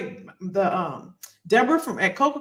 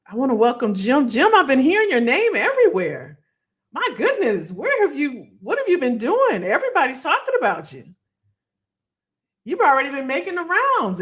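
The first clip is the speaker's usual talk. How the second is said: excited greeting; 6 semitones higher, 3 dB louder than usual. Retold or imitated. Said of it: "they were like".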